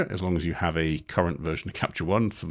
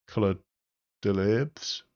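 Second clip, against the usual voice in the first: mock French accent; slower than usual. Shown as a demonstration. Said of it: "That's the best French accent I'm ever gonna do".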